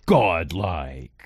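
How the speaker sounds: low, drawn-out arcade announcer; game announcer. Acted. Mock game announcer kill-streak call.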